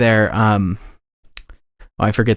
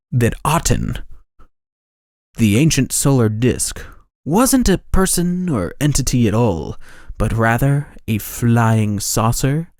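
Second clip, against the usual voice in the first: ancient alien voice. Shown as a demonstration. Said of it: "let me try my ancient alien voice for this".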